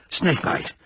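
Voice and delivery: gasped out with no voice. Acted. Exhausted rescuer gasping the news, voiceless. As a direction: gasp it out, spent and breathless. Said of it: "Quillerin gasped".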